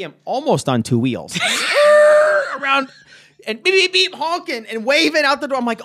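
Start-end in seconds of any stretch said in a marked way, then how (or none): 3.5-4.1 imitated car horn.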